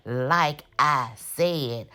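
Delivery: very slow, drawn-out mimicry; mimicked insistence. Recapper mimicking Fatima in the argument, slow and insistent. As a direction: stretch each word out, imitating her.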